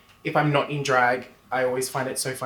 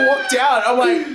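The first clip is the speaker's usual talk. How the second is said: ordinary talk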